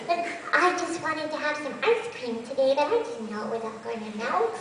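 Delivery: put-on high, whiny little kid voice; small child. Shown as a demonstration. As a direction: sulk like a disappointed small child. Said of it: "You wanna have your small child on there".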